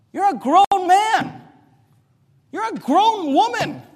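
high, incredulous preacher's rebuke; exasperated high-pitched dressing-down, ending loud; scolding rebuke. Real, not acted. Preacher rebuking those who blame others.

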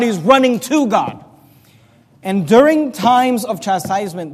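loud, high fervent sermon; full-force preaching. Acted. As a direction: preach it out to the congregation.

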